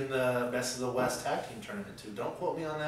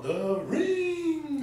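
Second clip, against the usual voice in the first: high ring announcer yell; lucha libre announcer; 3 dB louder than usual. Retold or imitated. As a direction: bellow it like a wrestling promo. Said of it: "Can you imagine him doing a promo?".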